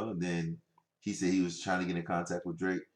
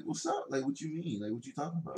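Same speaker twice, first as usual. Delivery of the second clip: high mock voice; 7 semitones higher, 5 dB quieter than usual. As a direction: squeak it out, playing dumb. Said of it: "was like".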